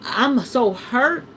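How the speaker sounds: usual speaking voice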